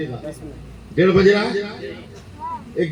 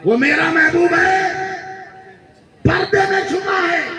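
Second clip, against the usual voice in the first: high, slow ecstatic shout; shouted climax; 14 semitones higher, slower than usual. Real, not acted. Preacher repeating the line to the crowd, ecstatic shout. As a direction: cry out वो मेरा महबूब है high and slow. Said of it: "repeated louder".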